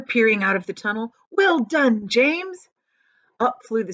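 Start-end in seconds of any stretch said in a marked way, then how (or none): 1.3-2.7 high, bright call of praise; a happy call out; excited praise. Acted. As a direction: cheer him on from the tunnel. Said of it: "shouted the old green grasshopper".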